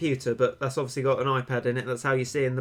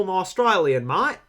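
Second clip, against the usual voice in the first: higher, broad mock Australian accent; 5 semitones higher, 4 dB louder than usual. Shown as a demonstration. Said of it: "are you Australian or British?".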